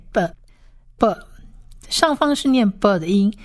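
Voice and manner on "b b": b as a short "buh", slowly; isolated, spaced-out "buh" for b; letter-sound demo. Shown as a demonstration. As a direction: sound out b as buh, with a pause between.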